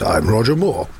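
a light Roger Moore impression. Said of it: "himself, says".

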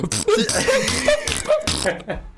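squealing laugh up high; a shrieking burst of laughter. Real, not acted. Laughing at the fart.

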